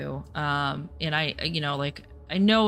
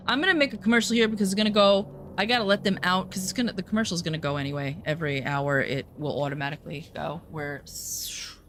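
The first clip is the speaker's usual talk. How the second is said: ordinary delivery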